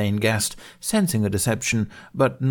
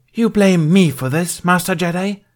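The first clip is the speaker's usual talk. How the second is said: spat; 11 semitones higher, 7 dB louder than usual. Acted. Thane spitting an accusation at the Jedi. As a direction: spit each word back at him. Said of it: "Thane spat".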